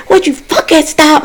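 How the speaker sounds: high-pitched exasperated outburst; retold yell. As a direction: act out yelling after being woken. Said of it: "I had to yell down the steps".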